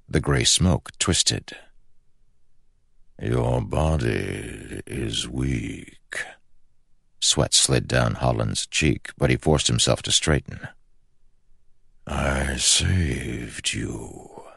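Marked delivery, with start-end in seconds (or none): 3.2-6.2 slow, soft and hollow; slow ancient shadow voice. Acted. The shadow speaks to Holland. 12.0-14.4 very slow, soft and hollow; slow ancient shadow voice. Acted. The shadow speaks.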